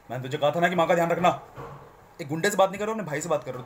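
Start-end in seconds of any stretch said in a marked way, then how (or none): 0.1-1.4 loud harsh scolding as if to a thug; like to a goon. Shown as a demonstration. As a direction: bark it like talking to a goon. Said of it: "ऐसा नहीं है कि तुम गुंडे से बात करो". (गुंडे से बात करने जैसा कड़क लहजा)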